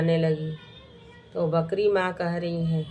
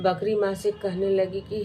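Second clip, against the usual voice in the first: plain reading voice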